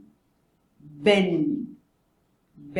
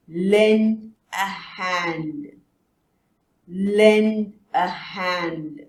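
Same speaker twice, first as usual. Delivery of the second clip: Lend a hand drawn out very slowly; slow teacher modelling; very slow model; much slower than usual. Shown as a demonstration. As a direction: drag each word for repetition. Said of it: "repeat after".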